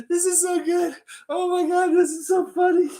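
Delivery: high, squeezed by real amusement; cracking up. Real, not acted. Teller breaking up before the punchline, cracking up. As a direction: squeal it out, barely holding the laugh.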